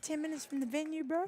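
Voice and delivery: imitating a friend's Kiwi accent, half-hearted. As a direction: quote your Kiwi friend in a breezy New Zealand accent. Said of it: "Paula has quite a thick accent".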